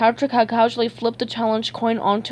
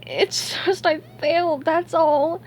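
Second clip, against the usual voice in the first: high, tearful SpongeBob voice; acted weepy defeat; crying; 8 semitones higher, slower than usual. A narrator's reading. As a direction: whimper it in a high voice, near tears.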